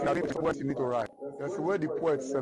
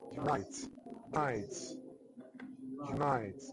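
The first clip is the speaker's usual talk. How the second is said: "unite" with the weight on its last syllable; stress on second syllable; 6 dB quieter than usual. Shown as a demonstration. Teacher showing where a verb takes stress, second syllable of "unite" stressed. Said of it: "right syllable".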